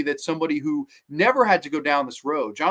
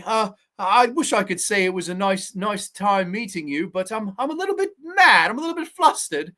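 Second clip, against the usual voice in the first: undead victims' mock complaint; 3 semitones higher than usual. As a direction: imitate politely aggrieved victims, voice rising toward the end. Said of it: "they're all like".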